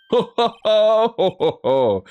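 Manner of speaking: loud, high, drawn-out laugh; hearty character laugh. Acted. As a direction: let out a big theatrical laugh. Game character laughs in court.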